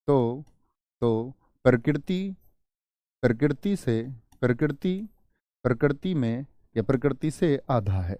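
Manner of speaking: slow teacher dictating, long pauses between chunks; dictation. Acted. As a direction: stretch and repeat phrases for note-takers. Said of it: "लिख लेंगे।".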